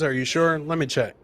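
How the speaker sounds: normal talking voice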